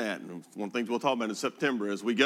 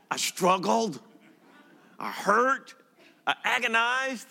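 much higher, building hammed-up anguish; mock agony; 11 semitones higher, 3 dB louder, slower than usual. Pastor joking about choosing between wife Mary and a dog, mock agony. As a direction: overplay the torment for laughs.

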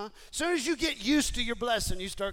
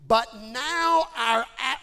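loud, slow, drawn-out moan; mock whining; 5 semitones higher, 9 dB louder, much slower than usual. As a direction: start the complaint as a long groan. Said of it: "I'm going with the works on that".

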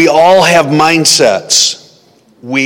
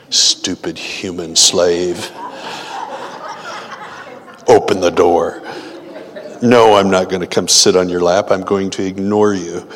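haughty cat voice; 5 semitones lower than usual. Retold or imitated. Cat sneering at its human servant, lightly voiced disdain. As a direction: voice a cat that scorns its owner. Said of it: "Cat's going".

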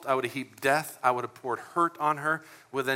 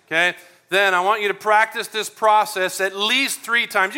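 loud, high, driving pastor's charge; rally the church to do the homework; fiery preaching; 5 semitones higher, 8 dB louder than usual. Real, not acted. Pastor urging the congregation to memorize the steps, loud and fervent.

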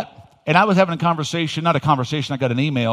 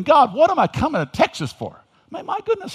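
exasperated plea; 13 semitones higher, 3 dB louder than usual. Retold or imitated. Pastor questioning God while driving.

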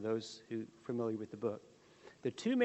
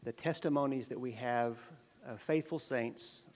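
prayer; 3 semitones lower than usual. Acted. Opening prayer before the lesson.

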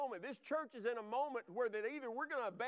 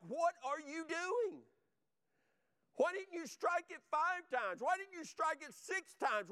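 higher-pitched, indignant retold rebuke; angry prophet; 5 semitones higher than usual. Elisha on his deathbed rebuking the king, imitated in anger. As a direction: demand answers like an exasperated prophet. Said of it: "was angered".

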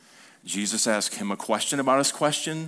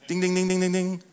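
high sing-song bell dings for a right answer; mock quiz-show ding; 7 semitones higher than usual. Preacher to congregation, cheerfully imitating a correct-answer bell. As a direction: ring it like a game show bell.

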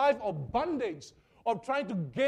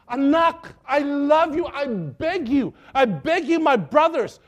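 pleading parent; 3 semitones higher, 9 dB louder, slower than usual. Retold or imitated. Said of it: "for me to beg my child".

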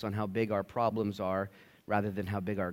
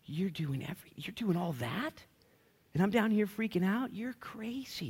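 hushed, half-whispered awe, rising higher; breathy whisper climbing to a high, soft disbelief; muttering under breath; 7 semitones higher, 5 dB quieter than usual. Retold or imitated. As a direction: mutter to God in soft, incredulous amazement. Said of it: "mutter under my breath".

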